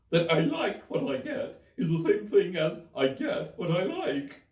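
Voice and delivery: high, loud hare voice; March Hare voice. Acted. March Hare questioning Alice, high and loud.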